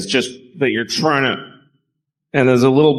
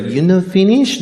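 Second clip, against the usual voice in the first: put-on Japanese accent; 9 semitones higher than usual. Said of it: "It's like".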